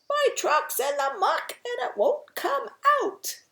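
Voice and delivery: high, loud cry for help; shouting. A narrator's reading. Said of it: "that hears the shout".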